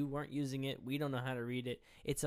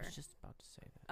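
breathy mock-sulky whisper, fast; 3 semitones higher, 11 dB quieter, faster than usual. Real, not acted. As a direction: hiss it under your breath. Teasing sulk after being interrupted.

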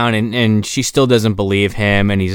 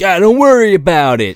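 mock reassuring thug; 9 semitones higher, 5 dB louder than usual. Kidnapper voiced in reply.

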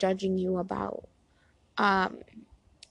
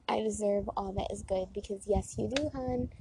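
ordinary talk

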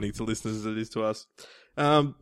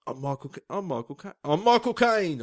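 repeated tries at a London actor; Michael Caine; 4 semitones higher than usual. Acted. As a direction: climb into a high Cockney voice. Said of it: "I'm Cockney".

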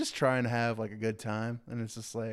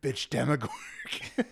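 slow put-on tough guy, laughing; hammed-up slow threat through laughter; mock tough quote; slower than usual. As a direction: growl it like an action hero. Stranger Things impression, laughing.

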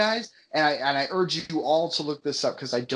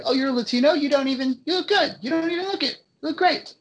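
imitating a gushing stranger; 10 semitones higher than usual. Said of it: "when someone's like".

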